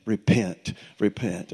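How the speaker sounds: mild mock weeping, dying off to near silence; retold sobbing. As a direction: echo her sobbing plea, trailing away. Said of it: "in just her sobs".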